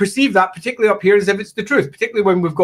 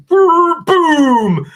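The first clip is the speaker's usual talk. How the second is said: mock explosion; 13 semitones higher, 4 dB louder than usual. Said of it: "British Navy literally bombed Dublin".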